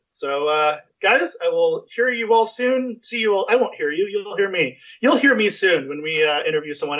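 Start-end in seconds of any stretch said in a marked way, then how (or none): none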